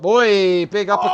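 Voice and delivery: loud, sudden play-by-play burst; excited call. Real, not acted. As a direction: call the uppercut with a rush. Live call of a big punch.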